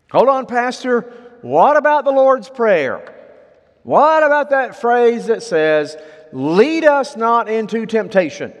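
high, loud interruption; challenging objector. Retold or imitated. Listener interrupting the pastor over the Lord's Prayer, challenging.

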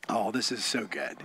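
quiet wonder; hushed delight. Real, not acted. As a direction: murmur it in awe. Preacher savours the verse.

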